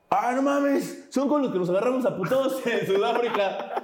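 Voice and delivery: high, laughing disbelief; imitating a shocked cameraman; surprised recognition. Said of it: "Y dice".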